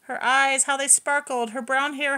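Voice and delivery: plain reading voice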